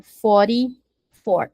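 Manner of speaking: slow, careful English model word; drawn-out teaching pronunciation. Shown as a demonstration. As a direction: say it slowly for the class. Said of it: "and quarenta e quatro".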